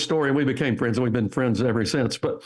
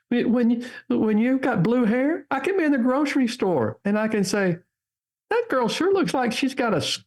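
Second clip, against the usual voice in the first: put-on playful New Hampshire accent, pitched high and slowed; 11 semitones higher than usual. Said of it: "I'm gonna do my best New Hampshire accent".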